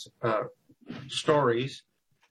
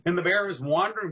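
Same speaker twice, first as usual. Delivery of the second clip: usual speaking voice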